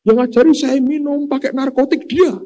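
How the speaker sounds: careless mourner. Retold or imitated. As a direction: squeal out a careless friend's fake sorrow. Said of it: "Ngomongnya seenaknya".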